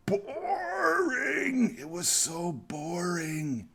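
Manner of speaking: boring, stretched out high and slow in a mock whine; whiny mock boredom. Reviewer groaning about a dull film, mock whiny and slow.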